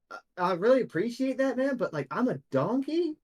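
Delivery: high, hesitant donkey voice; reluctant, high-pitched objection; Bill the donkey. Acted. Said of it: "And he goes".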